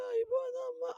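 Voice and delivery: high, hammed-up fake weeping; mock tearful whimper. Preacher mocks a mourner's fake crocodile tears, high whimper. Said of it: "ముసలి కన్నీళ్ళు కొంచెం కారుస్తాం".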